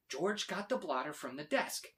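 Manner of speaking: usual speaking voice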